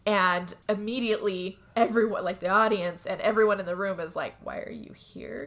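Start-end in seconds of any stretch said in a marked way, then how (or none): 4.4-5.5 whispered slowly, mocking the class. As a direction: breathe it as a slow whisper. Classroom stares at latecomer.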